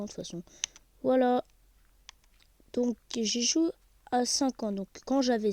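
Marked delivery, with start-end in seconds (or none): none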